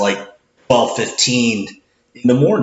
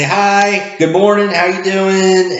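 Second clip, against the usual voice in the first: higher, upbeat, slowing on "how you doing"; cheery cashier greeting; 8 semitones higher, slower than usual. Retold or imitated. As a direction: sell a bright morning hello.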